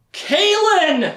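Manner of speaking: sharp gasp then the name cried out high; a sudden shocked gasp of remembering; gasped realization. Retold or imitated. Retelling Richard remembering Kaelin, gasping high.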